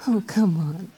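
ordinary talk, nothing marked

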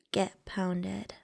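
plain reading voice

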